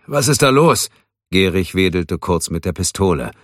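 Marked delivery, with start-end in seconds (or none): 0.1-0.9 higher, louder voice through a phone; alarmed caller on the line. A narrator's reading. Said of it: "Wirths Stimme tönte aus dem Telefon". (Stimme aus dem Telefon)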